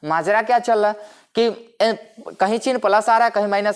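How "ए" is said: usual speaking voice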